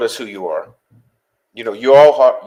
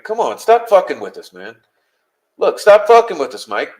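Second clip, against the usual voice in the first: raised, exasperated demand; fed up, telling him off loudly; higher and louder, frustrated; angry; 5 semitones higher, 4 dB louder than usual. Real, not acted. Angry rant at silent listener.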